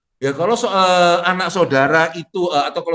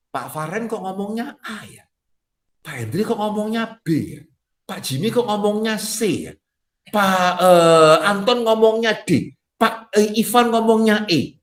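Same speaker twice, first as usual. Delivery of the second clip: higher-pitched puzzled questioning; confused congregant; 6 semitones higher, slower than usual. Acted. Church member questioning why each pastor says something different, confused. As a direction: lightly voice a baffled church member listing contradictions. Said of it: "kebingungan saudara itu gara-gara".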